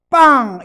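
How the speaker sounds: sudden loud spoken bang; one loud popping shout; imitated bang. Shown as a demonstration. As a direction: burst out with an explosive "bang".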